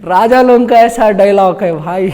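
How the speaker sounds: laughing through the words; amused laughing. Real, not acted. Preacher jokes with congregation about the king's dialogue, amused laughing.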